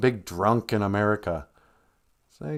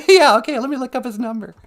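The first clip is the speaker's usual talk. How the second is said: mock high voice; 9 dB louder, faster than usual. Joking about phoning Prince Harry.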